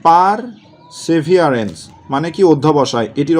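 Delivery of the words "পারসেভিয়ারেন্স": very slow dictation. Shown as a demonstration. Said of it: "teacher slows the spelling word".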